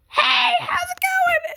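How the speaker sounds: mock loud whisper. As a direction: parody a whisperer who is too loud. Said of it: "How do people go like".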